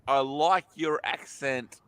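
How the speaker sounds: slow and deliberate, I like your accent; slow repeated phrase. Shown as a demonstration. Repeating himself slowly to his friend, deliberate. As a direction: spell it out slowly. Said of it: "I said three times".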